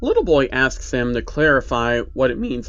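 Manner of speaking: plain reading voice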